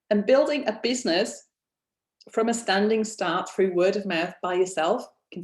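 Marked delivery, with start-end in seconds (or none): none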